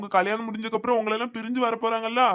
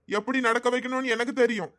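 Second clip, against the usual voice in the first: normal talking voice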